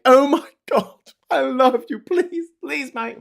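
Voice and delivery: very high, loud gushing parody; mock adoring fan. Fans swooning at a posh plummy accent, mock squeal. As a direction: squeal with hammed-up devotion. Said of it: "and they're like".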